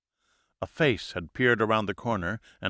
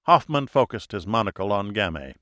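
usual speaking voice

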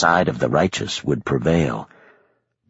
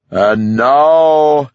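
booming high shout; 7 semitones higher, 7 dB louder than usual. Acted. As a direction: call out so it carries for miles. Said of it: "he shouted".

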